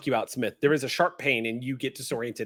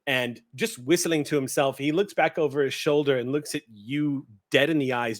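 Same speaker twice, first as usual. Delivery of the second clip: normal talking voice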